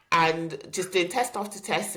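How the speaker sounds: ordinary talk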